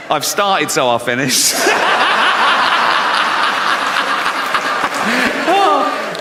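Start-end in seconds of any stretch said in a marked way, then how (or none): 1.6-5.5 squeaky laugh from the chest; helpless giggling fit; high giggle. Real, not acted. Laughing again.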